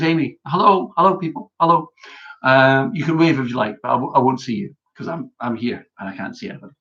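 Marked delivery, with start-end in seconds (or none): none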